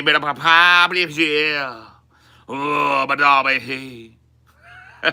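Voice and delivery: loud, high, slurred mock singing. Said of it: "[name]'s slurry voice".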